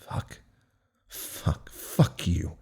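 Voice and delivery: bitter whispered swearing under the breath; whispered curses. Acted. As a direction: hiss the curses almost silently. Inner cursing under breath.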